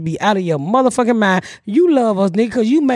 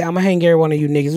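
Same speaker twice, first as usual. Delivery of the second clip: lower, rapid grandfather threat; a lower, quick menacing granddad; low fast threat; 7 semitones lower, much faster than usual. Retold or imitated. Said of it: "He said".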